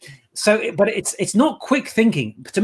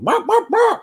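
high-pitched mock clucking; three high hen squawks; imitated chicken squawk. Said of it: "What kind of chicken?".

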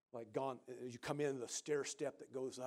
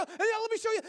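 much higher, louder and fast; eager hurry; 13 dB louder, faster than usual. Retold or imitated. Jesse hurrying guests to the next room, high, loud, fast. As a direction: rush them along like a kid.

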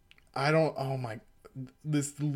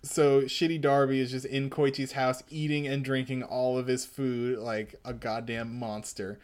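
normal talking voice

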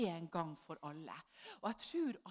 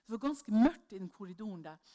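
ordinary talk

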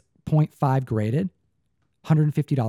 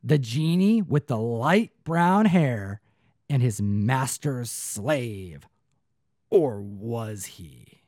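slow, drawn-out movie trailer read; dramatic announcer; much slower than usual. Acted. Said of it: "And it says here".

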